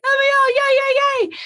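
very high, loud squealing yays; dog's frantic joy. Retold or imitated. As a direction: squeal like a wild puppy. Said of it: "gyrating in the crate like".

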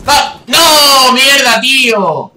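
high, loud cry of dismay; frustrated shout. Real, not acted. Gamer cursing a bad moment in the match, frustrated shout. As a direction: yell it out, dragging the curse. Player fails mid-game.

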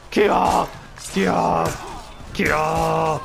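high shouted attack cries; repeated battle cry. Pretend. Gamer hacking through soldiers, shouted battle cries. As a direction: shout one cry per blow.

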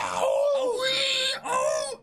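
high, loud pained cries; pained yelps. Acted. Pirate stepping on caltrops, pained yelps. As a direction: yelp as caltrops stab.

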